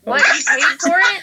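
high, loud disbelieving question; can't believe what you just heard; shocked disbelief. Real, not acted. Shocked the theme song cost money.

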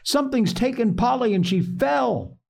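put-on high-pitched, urgent boy's report; alarmed cry. A narrator's reading. Joel tells his mother Polly fell, alarmed cry. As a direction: voice a panicked child, pitched high without full shouting. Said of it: "screamed Joel".

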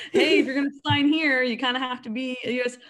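loud, high mock announcement; mock recruiter. Imagined recruiter at the door to a new cadet, mock helpful. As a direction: imitate someone flagging you down at sign-up.

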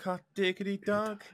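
lightly squeaked Mickey catchphrase; Mickey Mouse voice. Pretend. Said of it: "that's the tune of the Mickey Mouse".

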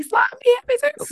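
very high, rushed mock plea; playful begging. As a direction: squeal it fast like a joking beggar. Jokingly begging for the pills.

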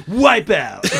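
loud laughing surfer cry; surfer yell. Retold or imitated. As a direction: shout it like a cartoon skater. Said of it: "So they go".

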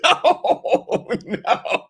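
high breathless laughter through no; giggling no, no; laughing hard. Real, not acted. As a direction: laugh it out, barely able to speak. Laughing at helmet joke.

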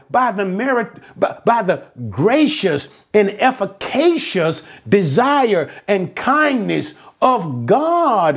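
high, insistent pulpit delivery; impassioned preaching. Real, not acted. Preaches against salvation by works.

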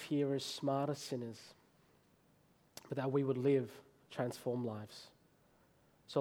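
low, hushed public prayer; quiet, measured prayer with pauses. Real, not acted. Leads a prayer.

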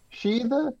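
tentative "She the", pitched high; unsure pronunciation. Real, not acted. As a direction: try out "She the" as an uncertain two-syllable guess. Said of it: "anybody know how to pronounce that".

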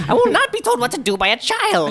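high, hammed-up outraged refusal; mock indignation. Stuck adventurer refuses advice from a child, hammed-up indignation. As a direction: protest in high-pitched wounded pride.

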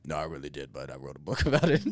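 imitating coworker Mike. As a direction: do the burly old chain smoker, low and easy. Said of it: "he spoke like that".

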